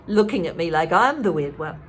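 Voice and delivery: ordinary delivery, nothing marked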